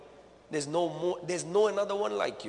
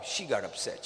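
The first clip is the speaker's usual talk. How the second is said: normal talking voice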